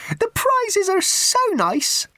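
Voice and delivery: very high, slow, sugary; simpering raffle woman. Acted. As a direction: drag it out with prim theatricality. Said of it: "a smile that suddenly snapped".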